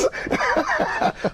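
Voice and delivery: high breathy laugh; delighted laugh. Retold or imitated. [name] laughing as he greets [name]. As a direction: burst into glad laughter.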